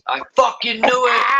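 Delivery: high, strained cry of triumph; hammed-up high-pitched gotcha; mock vindicated shout. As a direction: imitate a conspiracy believer proved right. Voices outraged conspiracy-minded viewers.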